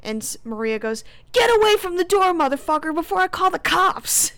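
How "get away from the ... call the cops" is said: loud, high, acted threat; angry shout. Maria ordering Sean away from the door, angry shout. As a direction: yell it at full force to scare him off.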